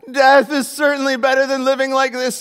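loud, high, overacted wail of despair; dramatic exclamation. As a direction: ham it up like a tragic stage actor. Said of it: "he exclaimed".

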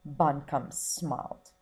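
ordinary delivery, nothing marked

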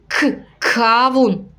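slow "К" held out before "кавун"; stretched first sound. Shown as a demonstration. Teacher showing parents the first sound, slowly. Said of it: "Ми даємо такий наголос, да, на перший звук".

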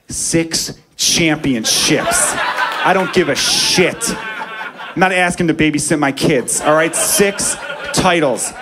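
slow, laughing mock shout; defiant laughing yell. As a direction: bellow it back while cracking up. Shouting back at doubting friends.

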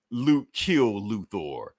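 slow, spaced robotic order; a machine voice giving a kill order slowly; cold command. Acted. The rogue AI ordering Luthor's death, slow and robotic. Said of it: "basically it's just saying".

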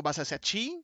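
unsure Basaseachi, stress thrown onto the ending; alternative pronunciation. Shown as a demonstration. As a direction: lift the last syllable of Basaseachi like a question. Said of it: "I don't even know how to pronounce that one".

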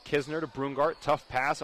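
ordinary talk

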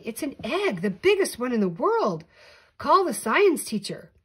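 loud, high excited shouting. A narrator's reading. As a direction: shout it to the kids, thrilled. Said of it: "He shouted to some nearby children".

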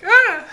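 sudden very high loud yelp; mock startled yelp. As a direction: yelp like the cat just shot past you.

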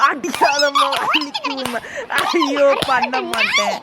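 put-on high wailing cries of a beaten child; hammed-up tearful wailing; mock crying wail. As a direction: sob and plead for the hitting to stop.

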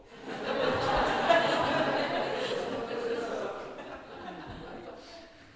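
long high burst of laughter; loud laugh. Real, not acted. Audience laughing at prayer.

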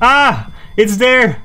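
real fright, high loud shout; startled scream. Real, not acted. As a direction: scream as the ghost appears. Ghost appears, fright.

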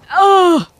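loud, high-pitched "oh"; startled yell. Real, not acted. Game scare outburst.

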